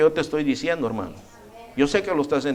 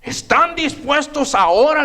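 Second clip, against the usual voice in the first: much higher, pressing question; demanding king; 10 semitones higher, 3 dB louder than usual. Retold or imitated. King pressing the three young men, high demanding question. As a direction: push them for an answer. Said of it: "le dice".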